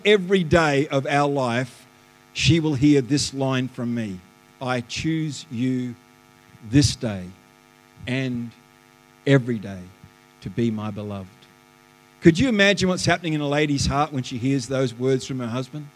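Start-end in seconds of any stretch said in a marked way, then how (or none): 4.6-11.3 tender vow. Retold or imitated. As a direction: savour each phrase of a solemn promise. Reciting wedding vow to his wife.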